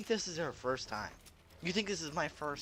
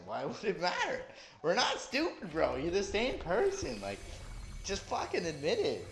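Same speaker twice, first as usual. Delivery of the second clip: raised, heated call-out, slowing on stop lying; exasperated yelling; 7 semitones higher than usual. Real, not acted. Streamer yelling at a cheater in chat, exasperated shout. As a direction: yell at him to just admit it.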